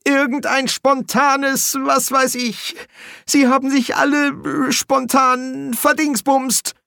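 high, slow, faltering whine; whimpering panic. A narrator's reading. Bursar fears everyone spontaneously vanished, whimpering. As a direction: wail it, groping for words.

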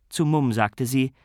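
plain reading voice

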